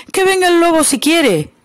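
high, bold little flea daring the wolf; defiant flea. A narrator's reading. The flea daring the wolf to come, high and bold. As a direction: squeak out the dare to the wolf. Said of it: "dijo la pulga".

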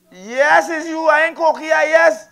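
loud, high comeback at the crowd; loud retort. Real, not acted. Comic answers a cocky audience member, loud high retort. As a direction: fire back high and loud at the heckler.

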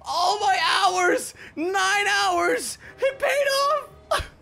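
real disbelief after nine hours of grinding; overjoyed streamer finally finds the key; ecstatic shouting. Real, not acted. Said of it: "I'm gonna cry".

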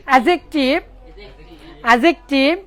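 অ্যাডজেক্টিভ as "a-jek-tiv", no d sound; silent d. Shown as a demonstration. Teacher answering how it sounds without d, অ্যাডজেক্টিভ with silent d. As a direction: drill অ্যাডজেক্টিভ, leaving out the d. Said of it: "ডির উচ্চারণ যদি না হয়".

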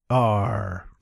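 low growled pirate Arr. Pretend. Talk Like a Pirate Day joke.

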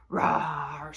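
low growly dinosaur roar. Pretend. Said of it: "pretend to be a dinosaur".

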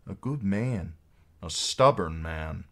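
ordinary delivery, nothing marked

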